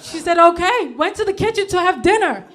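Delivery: high, loud comic outrage; still mad. Acted. Comic airing an old dinner grievance, loudly mad. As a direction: rant indignantly, voice pushed up. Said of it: "I'm still mad about it".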